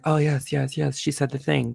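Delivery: lightly mocking, fawning approval; mocking gushing fans. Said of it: "And they're like".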